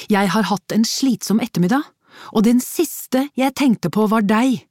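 voice rising. A narrator's reading. Wife snapping back at husband, voice rising. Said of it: "Stemmen hennes steg flere hakk".

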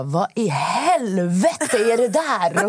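high, slow, drawn-out shock; shocked friend. Retold or imitated. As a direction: stretch it out as if you can't believe your eyes. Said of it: "hon bara".

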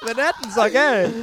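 asking through laughter, slow; a laughing question. Real, not acted. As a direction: Aarhus phrase laughed out slowly. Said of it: "laughs after the phrase".

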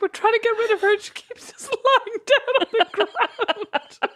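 high, slow, laughing exasperated kidnapper; laughing mock exasperation. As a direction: giggle through an exasperated outburst. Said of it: "They'd be like".

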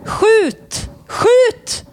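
high, loud re-enacted scream for help; retold panicked shout. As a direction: yell it as if pleading for a gunshot. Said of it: "Jag skriker till Niklas". (återgivet panikskrik, högt och gällt)

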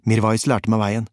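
ordinary delivery

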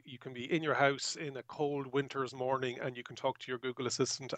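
usual speaking voice

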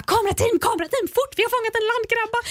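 high, breathless shouting for the crew; excited calling out. Retold or imitated. Running into camp to call the film crew, high and excited. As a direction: call the camera team urgently. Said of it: "sprang vi till lägret och bara".

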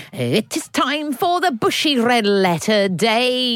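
slow, grand announcement; announcer voice. Acted. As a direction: announce it like a jingle. Jingle-style announcement.

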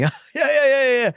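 keen lad agreeing to drinking before training; eager high yeses. Retold or imitated. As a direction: gush yes to everything.

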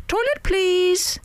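high, slow sing-song command; dog command voice. Retold or imitated. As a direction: coax the dog, high and drawn out. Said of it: "In my house, I say".

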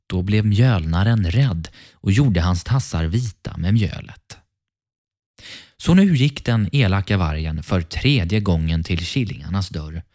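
ordinary delivery

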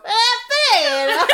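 loud, slow, high Italian parody; squealed Italian accent, half laughing; high-pitched mock Italian. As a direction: mimic a friend's shouty Italian accent, very high. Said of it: "High pitched at the end".

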